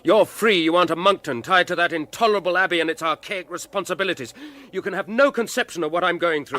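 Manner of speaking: high, loud, desperate pleading; anguished outburst. Acted. Alfred bursting out at his friend, anguished and loud. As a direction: cry it out, at the end of his rope.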